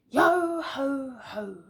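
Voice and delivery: slow, loud pirate yo ho ho; pirate shout. Acted. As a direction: bellow like a pirate captain. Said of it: "shouted Pirate Arthur".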